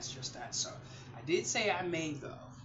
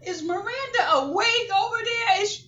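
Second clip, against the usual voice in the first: much higher, louder eager voice; imitated daughter; 14 dB louder than usual. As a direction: play the daughter now fond of the doll. Daughter now asking after doll.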